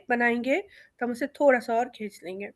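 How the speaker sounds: ordinary talk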